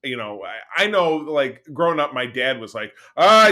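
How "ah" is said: loud, higher mock groan of protest; exasperated dad. Son imitating his dad dodging commercials, loud exasperation. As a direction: imitate Dad balking loudly at ads.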